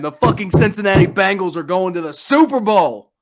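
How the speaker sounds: loud, emphatic proud proclamation; elated announcement. Real, not acted. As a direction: hit it hard like a proud fan.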